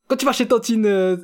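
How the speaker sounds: high, loud imitation of an auntie. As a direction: play the pushy host aunt. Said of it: "il disait, ouais".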